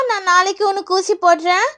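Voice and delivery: normal talking voice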